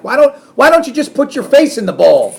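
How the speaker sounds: loud, high mock-incredulous jab; sarcastic. Dad mocking his slurping son, loud sarcastic sneer. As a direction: needle the messy kid with a high loud sneer. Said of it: "That, my son, is sarcasm".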